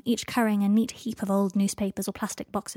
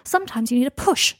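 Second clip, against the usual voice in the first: higher, louder snappy retort; snapped; 4 semitones higher, 6 dB louder than usual. A narrator's reading. Draco arguing back at Harry, snapped. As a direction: snap it back at him. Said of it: "Draco snapped".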